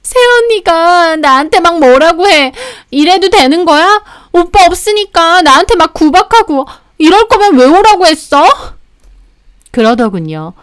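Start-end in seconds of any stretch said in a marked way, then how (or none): 0.0-8.4 tattle in an acted tearful voice; mock-tearful complaint. Sister-in-law tattling to her brother as he arrives, tearful whine. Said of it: "막 울면서".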